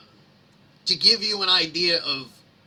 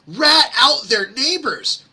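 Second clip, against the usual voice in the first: mock outrage; 10 semitones higher, 9 dB louder, slower than usual. Mocking complaint, peak.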